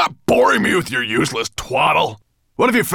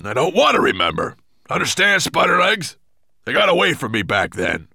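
loud, forceful monster voice; bullying roar; threatening growl; 6 semitones higher, 7 dB louder than usual. A narrator's reading. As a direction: menace your servant into silence. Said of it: "growled Nettlebrand".

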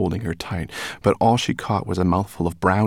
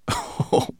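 mocking laugh. A narrator's reading. Brownie laughs.

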